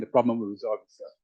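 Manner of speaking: ordinary talk, nothing marked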